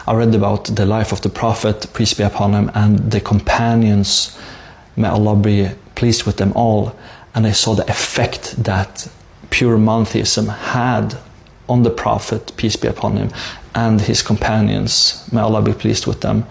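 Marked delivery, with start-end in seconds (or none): none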